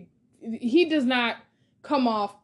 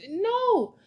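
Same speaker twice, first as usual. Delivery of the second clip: exasperated no; 11 semitones higher than usual. Real, not acted. Ranting about the movie plot.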